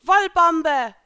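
high, very loud battle cry; spell shout. A narrator's reading. Heroine hurling wool attack at Elsa, loud shout. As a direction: yell out the attack.

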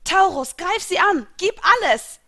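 high, loud battle order; urgent full-voiced command. A narrator's reading. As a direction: order your spirit to attack. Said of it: "befahl ich meinem Stellargeist".

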